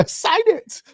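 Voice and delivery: very high squeaky mock demand; pushy agent impression. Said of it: "It sound like the guy that was telling".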